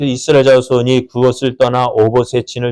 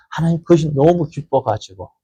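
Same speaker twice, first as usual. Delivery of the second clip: usual speaking voice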